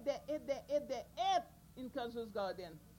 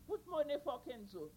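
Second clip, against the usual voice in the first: ordinary delivery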